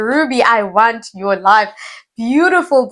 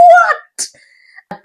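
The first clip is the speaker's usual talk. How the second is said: very high, loud, drawn-out disbelief; acted-out astonished cry; mock shock; 8 dB louder than usual. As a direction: squeal it as if stunned. Acted reaction to learning about BBL.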